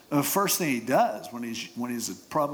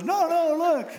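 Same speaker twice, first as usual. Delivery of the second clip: very high-pitched effeminate pleading; 4 dB louder than usual. Acted. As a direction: protest in a high falsetto, keeping up the trick. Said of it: "You kinda have that effeminate voice".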